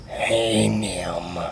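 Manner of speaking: lightly voiced low creep; creepy slow character. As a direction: greet softly, dragging it a little. Voices Steve greeting.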